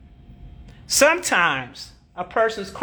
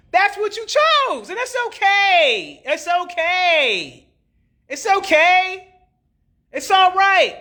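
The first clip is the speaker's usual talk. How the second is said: mock consoling; 9 semitones higher, much slower than usual.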